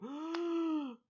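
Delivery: high shocked gasp; sudden high intake of breath; sharp surprised gasp. Real, not acted. Shocked gasp at Genghis Khan.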